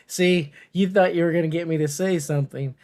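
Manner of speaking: normal talking voice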